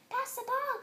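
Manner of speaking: high, loud call across the field; bossy shout on the soccer pitch. A narrator's reading. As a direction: yell for the ball. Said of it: "Carla shouted at Anna".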